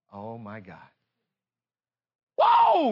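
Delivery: normal talking voice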